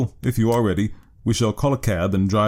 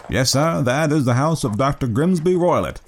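higher-pitched obliging coachman; a hint of a helpful cab driver, pitched up; lightly voiced driver; 5 semitones higher than usual. A narrator's reading. Driver answering his passenger about the house, lightly voiced and higher. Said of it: "remarked the driver".

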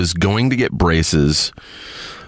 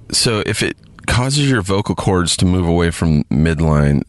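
usual speaking voice

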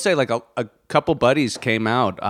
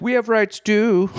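mock whiny Nazi; 11 semitones higher, slower than usual. Mocking Nazi complaint.

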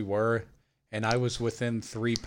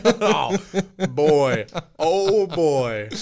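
laughing; 3 semitones higher, 7 dB louder, much slower than usual. Real, not acted. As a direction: burst out laughing. Laughs at the challenge idea.

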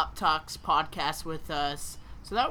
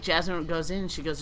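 ordinary delivery

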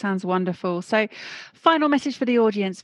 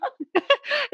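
a high teary giggle; real laugh, high and emotional; high tearful laugh. Real, not acted. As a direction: let a high laugh burst out through tears. Host breaks into high laugh.

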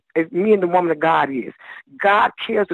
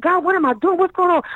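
much higher, bewildered plea; exasperated cry to God; 11 semitones higher than usual. Retold or imitated. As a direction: throw up your hands and ask God. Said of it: "I said".

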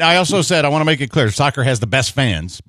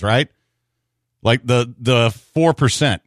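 ordinary delivery

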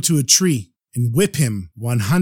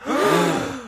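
sharp breathless gasp; shocked gasp. A narrator's reading.

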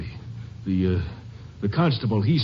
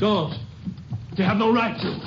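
sudden panicky higher pitch; alarmed protest; 9 semitones higher, 3 dB quieter than usual. Acted. Gambler panicking as the Ranger rips the wallpaper. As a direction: stop him, rattled and scared.

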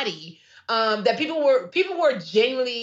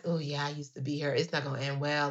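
hushed aside; 7 semitones lower, 8 dB quieter than usual. Retold or imitated. Quoted reformed viewer, hushed.

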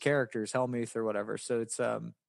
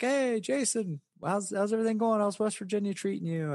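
much higher, friendly greeting voice; imitating Mike Sexton; 10 semitones higher than usual. Older pro greeting young Jason, imitated much higher. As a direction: pitch up and greet an old friend warmly. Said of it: "it would always be like".

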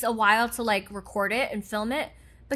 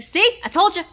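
high, loud told-you-so; triumphant gloating; 9 semitones higher, 6 dB louder than usual. Pretend. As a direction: crow it, vindicated. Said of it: "I'd be like".

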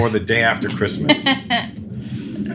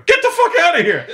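much higher, louder yell with a laugh in it; panicked yell; 5 dB louder than usual. Retold or imitated. Hiding under the crate, yelling at the girl to leave. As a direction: scream at her to get out of the booth.

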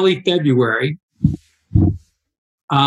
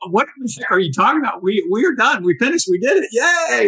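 higher-pitched retold disbelief, ending in a cheer; incredulous; 7 semitones higher than usual. Runner retelling his protest to his partner, incredulous. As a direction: recount your own baffled protest, building to a yay.

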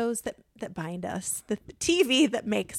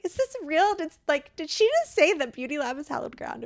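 amazed disbelief; 7 semitones higher than usual. Retold or imitated. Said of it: "I was like".

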